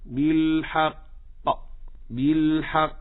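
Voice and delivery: بالحق stopped with a hard echoing bounce on ق; pause, then pop the final ق of بالحق; strong qalqala. Shown as a demonstration. Teacher models stopping on the word, strong qalqala. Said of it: "أعلى درجات القلقلة".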